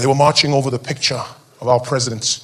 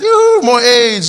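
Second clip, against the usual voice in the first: mock craving; 7 semitones higher, 4 dB louder, much slower than usual. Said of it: "after you're like".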